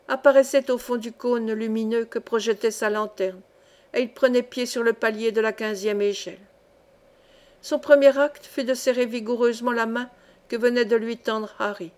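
ordinary delivery, nothing marked

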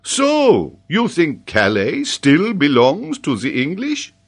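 high, loud, drawn-out disbelief; amazed professor checking the answer; incredulous questioning. Acted. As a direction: question slowly and pointedly. Paganel questions child in disbelief.

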